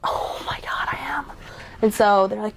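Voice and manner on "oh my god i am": softer whispered realization; stunned whisper. Retold or imitated. She realizes she's on the freeway, whispered shock. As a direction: breathe it out as the truth sinks in.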